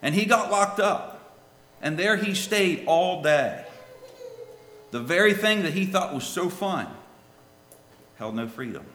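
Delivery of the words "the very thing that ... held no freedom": ordinary delivery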